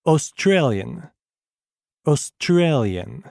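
Australian drawn out, STRA pressed; slow stress drill; slow stressed syllables. Shown as a demonstration. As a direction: stretch Australian around its stress. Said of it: "faites attention aux syllabes accentuées".

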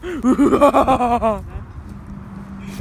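long, loud, high manic laugh. Acted. Love-giving yokai carries on, manic laugh. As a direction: cackle loudly after the chant.

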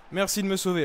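ordinary delivery

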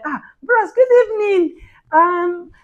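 high put-on girlish pleading; slim girl. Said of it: "one slim girl just enter".

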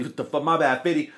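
ordinary delivery

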